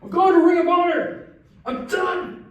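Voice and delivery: high, loud fast walkout yell; fed-up fan leaving in a mock rage; mock storming out. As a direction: announce you're quitting in a huff. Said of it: "Sorry for storming out again".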